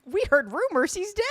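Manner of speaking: high, loud gossip bombshell; loud, high retold alarm; mock alarm. Acting out brother's alarmed text.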